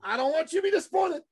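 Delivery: high, quick caricatured voice; insistent warning. Retold or imitated. Attendee warning host off the upsell, insistently. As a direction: mimic a man sure he is letting you down.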